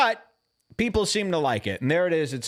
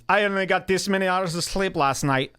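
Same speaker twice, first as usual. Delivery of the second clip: put-on stand-up voice; imitated comedian's new voice; 3 semitones higher than usual. Host mimicking the trailer's sleep joke, affected comic voice. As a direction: mimic his odd affected delivery. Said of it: "do you hear this voice?".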